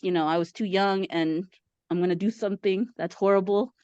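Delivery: ordinary delivery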